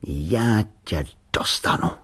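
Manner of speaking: slow, menacing whisper; voiceless, drawn-out menace; dangerous voice. A narrator's reading. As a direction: whisper it slowly as a threat. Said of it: "řekl nebezpečným hlasem".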